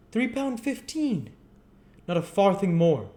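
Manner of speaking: high, loud final word; firm chairman. Acted. Limbkins gives Gamfield a firm final price, loud. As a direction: shut down the bargaining. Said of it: "the firm reply of Mister Limbkins".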